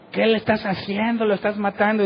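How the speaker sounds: reproche alarmado, bastante más agudo; acusación imitada. Retold or imitated. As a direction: imita a quien acusa en pánico, sin gritar. Acompañante acusa al que ora. (acusación aguda, actuada)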